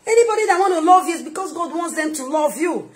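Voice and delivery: loud, high-pitched impassioned exhortation; full-force preaching. Real, not acted. Preacher urging listeners toward supportive people, full-force preaching. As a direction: build to shouting, stretching the final phrases.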